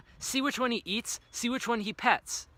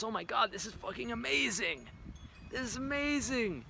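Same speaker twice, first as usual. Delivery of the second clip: softer, dreamy delight over the fruit; blissful savoring; 2 semitones higher, 8 dB quieter than usual. Pretend. Starving man biting a mango, softly blissful. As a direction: hush it like tasting heaven. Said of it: "I'm like".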